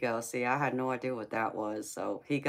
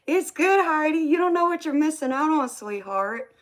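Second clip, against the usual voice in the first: excited teasing; 14 semitones higher, 8 dB louder than usual. Real, not acted. Teasing chat about Granny's food.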